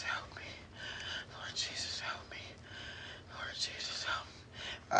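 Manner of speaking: hushed, repeated whisper prayer; whispered plea. Real, not acted. Pleading with Jesus for help, whispered. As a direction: whisper it over and over.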